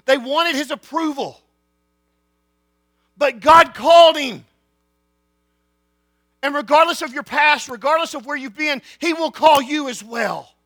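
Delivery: loud, high preaching climax; full-force preaching. Acted. Preacher booming the call to the congregation.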